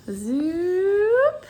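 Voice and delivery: high, drawn-out sliding zoop; imitated zoop sound. Real, not acted. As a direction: make a rising whoosh effect. Sound effect while moving something.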